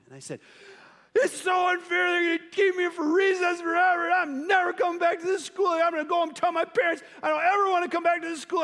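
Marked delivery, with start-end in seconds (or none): 1.1-8.6 put-on high, loud, tearful kid yelling; angry child shouting. Boy yelling at his teacher about recess forever, loud and high. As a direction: throw a furious child's tantrum. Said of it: "I gave her what for".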